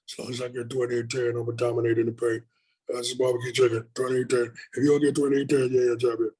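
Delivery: lightly done breathy, bassy Shaq voice; a low breathy big-man voice, said with ease; Shaq impression. Shown as a demonstration. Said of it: "a Shaq, Shaq is real breathy and bassy".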